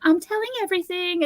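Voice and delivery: put-on squeaky high baby-bird voice; cute parrot baby voice. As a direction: chirp it like a tiny talking parrot. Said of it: "this cute little baby voice".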